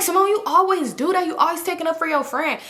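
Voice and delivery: high-pitched mock indignation; imitated accusing complaint. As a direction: accuse her, imitating a whiny friend. Imitates friend's complaint in quarrel.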